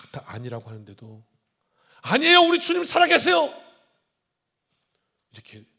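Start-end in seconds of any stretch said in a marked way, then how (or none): 2.0-3.5 much higher, loud fervent outcry; fiery declaration of faith; passionate confession. Retold or imitated. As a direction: burst out with zeal, 아니에요 rising. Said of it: "믿음이 열정적으로 올라와서 고백한".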